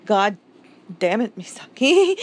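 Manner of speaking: ordinary talk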